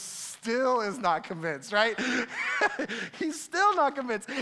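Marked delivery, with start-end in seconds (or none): 2.6-4.3 laughing through the words; amused laugh, pitch up. Real, not acted. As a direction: chuckle as you say it. Laughing at Moses' reluctance.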